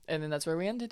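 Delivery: ordinary talk, nothing marked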